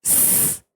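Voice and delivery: a long whistled s with no voice; s said as a sharp whistle; hissing s. Shown as a demonstration. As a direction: hiss the s like a whistle. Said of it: "city मारना".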